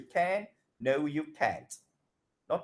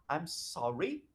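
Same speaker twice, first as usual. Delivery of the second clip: "sorry" lifted at the end; polite "I'm sorry" with a rise on "sorry"; intonation up; 3 dB quieter than usual. Shown as a demonstration. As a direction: let "sorry" go up, as if more follows.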